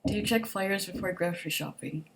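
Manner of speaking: usual speaking voice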